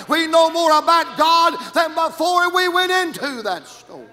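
loud, rising preacher's cadence; fiery preaching. Acted. Preacher to his congregation, loud rising cadence. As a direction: preach it to the rafters.

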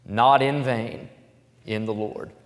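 slow emphatic "not in vain in the Lord"; stress on not in vain. Shown as a demonstration. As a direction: land the phrase slowly. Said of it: "and here's the phrase".

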